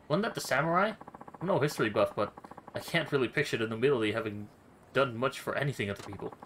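ordinary delivery

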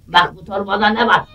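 usual speaking voice

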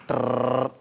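low trilled "trrr"; imitated drum roll. Host teasing the answer on how long love lasts, trilled drum roll. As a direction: roll the tongue for a suspenseful drum roll.

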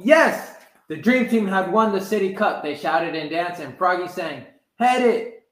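plain reading voice

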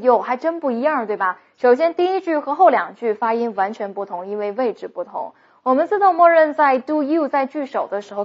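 ordinary talk

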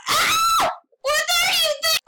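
a high, sudden scream of fright; startled scream then panicked question; frightened shriek. Real, not acted. Jump scare by animatronic.

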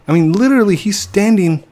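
high and loud, urgent pointing out; excited pointing. Real, not acted. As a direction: call attention, thrilled.

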